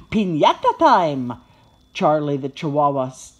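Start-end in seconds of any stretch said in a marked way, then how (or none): 0.1-1.3 loud little dog's cheer; excited yip. A narrator's reading. Charlie the Chihuahua as treats fly, yipping excitedly. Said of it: "yips excitedly".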